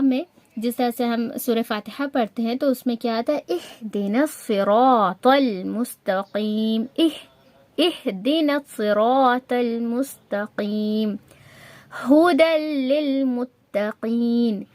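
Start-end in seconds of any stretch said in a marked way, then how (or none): 3.5-11.2 slow, drawn-out اہدنا with ہا from the chest; practice recitation. Shown as a demonstration. Teacher leading learners in slow recitation practice. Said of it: "ایک بار میرے ساتھ practice کریں". 12.0-14.6 very slow, sustained ھدی للمتقین; practice recitation. Shown as a demonstration. Teacher reciting an example for practice, slowly chanted. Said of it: "ایک بار میرے ساتھ practice کریں".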